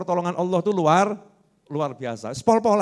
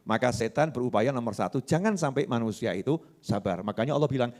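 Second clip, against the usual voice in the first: ordinary talk, nothing marked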